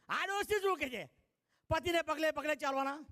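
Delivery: high, scornful mock question; mocking bride. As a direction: scoff at the granny's advice. Bride mocks the old woman.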